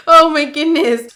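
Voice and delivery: loud high exclaim through tears; tearful exclaim. Real, not acted. Vlogger recovering from crying, loud tearful gasp.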